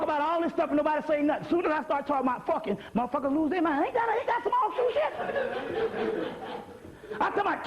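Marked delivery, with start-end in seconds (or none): 3.8-6.3 high, slow, laughing through the words; real amused laughter; laughing disbelief. Real, not acted. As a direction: cackle at how absurd it is. Laughing at the irony.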